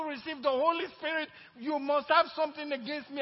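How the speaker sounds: high, loud and hurt, building to a cry; mother's hurt outburst. Acted. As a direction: plead like a wounded mother. Mother's hurt complaint acted.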